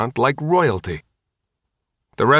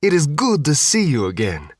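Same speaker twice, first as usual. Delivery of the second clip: put-on Sicilian accent; 4 semitones higher than usual. A narrator's reading. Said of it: "with a thick Sicilian accent".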